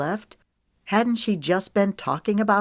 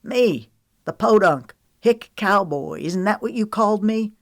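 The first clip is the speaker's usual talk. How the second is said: louder, bitter cowboy jab, rising up; rising, louder hurt anger; 5 semitones higher, 5 dB louder than usual. A narrator's reading. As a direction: throw the insult back at her, louder. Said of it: "His voice rose".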